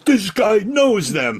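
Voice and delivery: high, loud, drawn-out crowd exclamation; amazed crowd. Retold or imitated. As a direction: stretch it out like an impressed audience. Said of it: "the crowd would be like".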